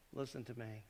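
normal talking voice

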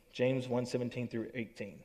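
ordinary talk, nothing marked

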